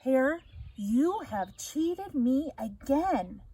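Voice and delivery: slow, grumbling bear accusing hare; lightly voiced scowling bear. Bear scowls at the cheating hare, slow and sulky.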